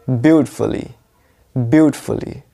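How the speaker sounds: "ब्यूटीफुली" with a clipped fali at the end; short fali ending. Shown as a demonstration. Teacher drilling the correct adverb ending. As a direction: say "ब्यूटीफुली" with a short middle vowel. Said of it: "तो कैसे आप बोलोगे".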